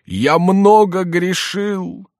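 high, loud, long-drawn lament; plaintive voice. A narrator's reading. Jester confesses many sins, plaintive drawl. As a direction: wail it slowly with mock remorse. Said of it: "затянул Шико жалобным голосом".

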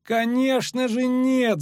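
high, drawn-out excited cry; shouting. A narrator's reading. Poet agrees they are no enemy, high shout. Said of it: "закричал Лютик".

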